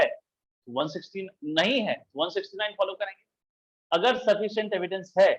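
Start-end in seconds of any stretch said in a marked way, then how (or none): none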